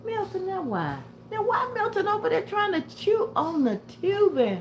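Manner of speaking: high, fond scolding for the dog; pet talk. Real, not acted. Teasing her dog for chewing the tubing, high pet voice.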